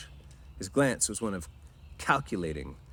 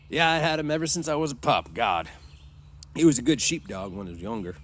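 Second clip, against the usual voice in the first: put-on loud, high-starting old-timer; fond old swamper; 4 semitones higher, 7 dB louder than usual. A narrator's reading. Old swamper talking of his sheepdog, fond. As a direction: reminisce heartily about your dog.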